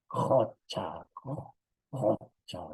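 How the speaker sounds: jocha with a strong rasping j, then jo- repeated slowly; teacher demonstrating a throaty jocha. Shown as a demonstration. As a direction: scrape the j in jocha, slowly and clearly. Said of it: "es un sonido especial".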